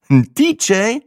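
sehr hoch und laut, verstellte Stimme; spöttisch ungläubig. A narrator's reading. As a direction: ein ungläubiges Ein D J quietschen. Matze mocking disbelief. (Matze macht sich über Sinas Vermutung lustig)

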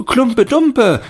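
put-on high, loud, slow children's cry; children shouting. A narrator's reading. Some children shout for their story, high and loud. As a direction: shout out your favourite. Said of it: "schrien andere".

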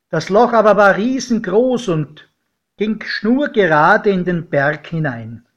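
ordinary delivery, nothing marked

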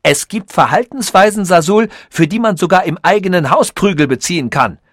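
heated threat. A narrator's reading. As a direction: lose your temper and threaten him.

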